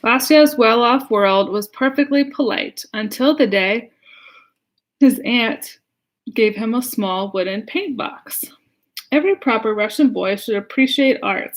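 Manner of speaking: put-on lively read-aloud to children; story reading. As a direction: read it like a picture book for kids. Reads picture book aloud.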